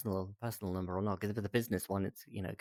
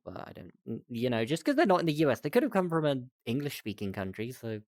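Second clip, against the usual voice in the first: normal talking voice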